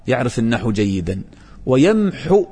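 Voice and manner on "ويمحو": ويمحو with the ح clearly breathed from the throat; يمحو بالحاء; stressed haa sound. Shown as a demonstration. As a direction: say ويمحو leaning on the ح. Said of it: "بالحاء ليس بالواو".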